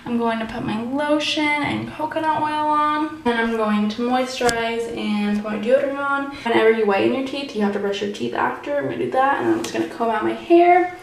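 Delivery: normal talking voice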